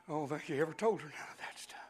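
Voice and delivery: fast, hushed whisper. Real, not acted. Preacher confiding an aside to the congregation, fast whisper. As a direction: lean in and whisper it.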